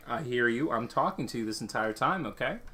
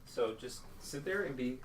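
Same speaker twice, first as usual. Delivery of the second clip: hushing; 2 semitones higher, 9 dB quieter than usual. Real, not acted. Dad hushing son during video.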